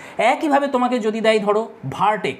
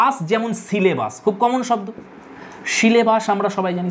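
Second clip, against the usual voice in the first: normal talking voice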